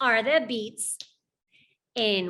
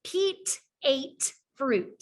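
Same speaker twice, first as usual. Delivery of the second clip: put-on slow model sentence for children; slow, clear sentence; slower than usual. Shown as a demonstration. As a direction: say each word slowly and clearly. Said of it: "It's the last sound in those words".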